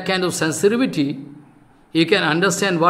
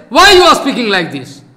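mock protest; 3 semitones higher, 7 dB louder than usual. Preacher voices the missing protester challenging him, loud. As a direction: voice an objector confronting him.

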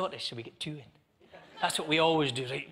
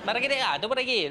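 Italian; 6 semitones higher than usual. Acted. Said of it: "How, how would he talk?".

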